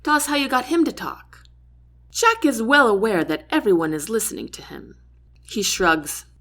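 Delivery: ordinary delivery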